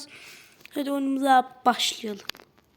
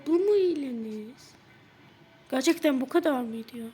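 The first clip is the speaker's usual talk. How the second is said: normal talking voice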